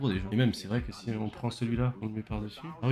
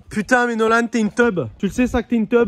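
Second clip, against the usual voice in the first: teasing yell; 9 semitones higher, 7 dB louder than usual. Real, not acted. Yelling at friend over play.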